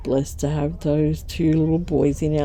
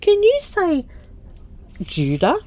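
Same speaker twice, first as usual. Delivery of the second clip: high coaxing "can you say" to a little one; lightly voiced coaxing mum; coaxing a toddler; 10 semitones higher than usual. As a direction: prompt a toddler to say his name. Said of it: "I said to him".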